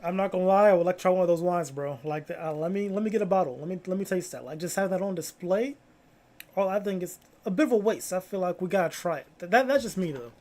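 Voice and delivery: loud, fast, playful complaining; loud rant. Real, not acted. Streamer loudly protesting the wasted wine, half joking. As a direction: hype it up, almost yelling at the screen.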